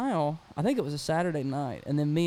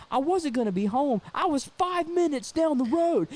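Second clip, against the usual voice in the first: high, acted indignation; indignant protest; 11 semitones higher than usual. Roommate denies he was far away, indignant. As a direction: imitate an exasperated roommate setting it straight.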